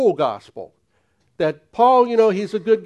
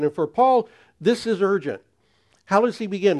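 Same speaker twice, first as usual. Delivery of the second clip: ordinary talk, nothing marked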